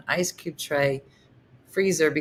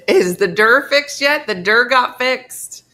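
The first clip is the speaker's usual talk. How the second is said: loud, high, laughing delivery; amused, triumphant call-out; gleeful announcement; 7 semitones higher, 9 dB louder, slower than usual. Real, not acted. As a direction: crow it happily, stretching the ending. Laughingly answers question about the door.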